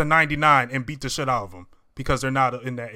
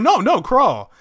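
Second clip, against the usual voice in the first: higher, louder mock insistence; insistent retold order; 10 semitones higher, 7 dB louder, slower than usual. Retelling the demand that Peter crawl, mock insistent. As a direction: push the retold command up high and loud.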